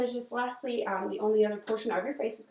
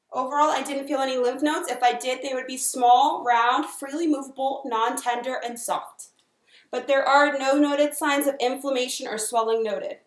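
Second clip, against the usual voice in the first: clinical dictation; 3 semitones higher, 7 dB louder than usual. Acted. Dictating exam findings aloud.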